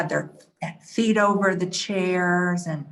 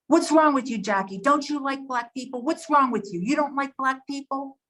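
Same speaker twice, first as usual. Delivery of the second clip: higher-pitched confrontation, imitating his outburst; retold angry yell; 6 semitones higher than usual. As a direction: fire accusing questions, mimicking someone yelling at you. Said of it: "and yelled at me".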